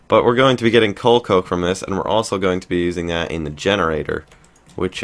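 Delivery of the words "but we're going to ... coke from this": said again louder and clearer; clear restart. Real, not acted. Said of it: "having trouble speaking today".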